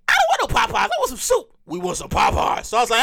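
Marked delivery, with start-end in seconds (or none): 0.1-1.5 higher, whiny refusal; protesting self. Retold or imitated. Arguing with his inner fat man, high protest. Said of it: "And I'm like". 1.7-2.7 low, breathy fat man insisting; whisper-insist like a craving; inner fat man. Retold or imitated. Inner fat man insisting on Popeyes, low and breathy. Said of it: "my inner fat man".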